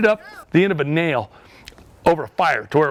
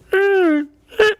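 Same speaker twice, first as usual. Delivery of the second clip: high-pitched imitation crying whimper; wordless, high mock-cry sound; mimed crying noise; 3 dB louder than usual. Guide acting out an ant bite's effect, mimed crying noise. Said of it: "you're gonna cry".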